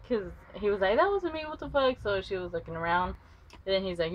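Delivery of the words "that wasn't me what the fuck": indignant denial. Retold or imitated. Demonte denies it.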